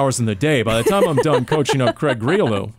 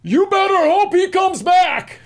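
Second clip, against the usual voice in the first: loud, very high-pitched drawn-out yell; full-out taunting shout across the field; Chandler Jones yelling; 5 dB louder, slower than usual. Retold or imitated. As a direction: holler it slowly, way up high. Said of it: "Chandler Jones was yelling at Kyler".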